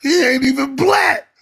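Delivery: mock disbelief. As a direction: act out your own stunned reaction. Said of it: "And I was like".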